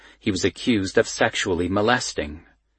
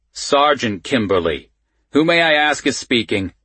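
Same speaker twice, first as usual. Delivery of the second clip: lightly voiced gruff Baltimore cop; gruff Baltimore accent; 5 semitones higher, 4 dB louder than usual. A narrator's reading. Sergeant asks who is calling, lightly gruff Baltimore accent. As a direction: correct the caller with a hint of gruff Baltimore. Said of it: "a man with a gruff Baltimore accent".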